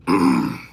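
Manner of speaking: harsh throat clear after the heat; coughing from the hot pepper. Real, not acted.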